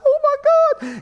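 a very high, delighted gasp of "Oh my God"; thrilled squeal. Retold or imitated. Preacher acting out hearing of a windfall, high squeal. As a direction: squeal it like you can't believe your luck. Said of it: "You'd be like".

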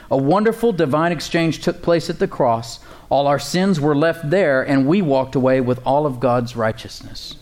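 normal talking voice

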